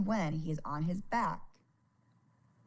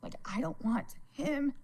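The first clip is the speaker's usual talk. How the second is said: holding back tears as she speaks; near tears; 10 semitones higher, 4 dB quieter than usual. Real, not acted. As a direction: voice breaking high, fading softer. Fears son losing his dad.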